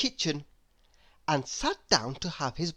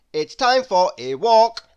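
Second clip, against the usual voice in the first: bossy announcement; 5 semitones higher, 8 dB louder than usual. A narrator's reading. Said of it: "they said".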